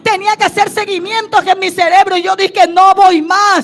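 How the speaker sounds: full-force preaching. Acted. Preacher declaring to the congregation her faith in Jehová, full-force shout. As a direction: build to a defiant, triumphant cry of faith.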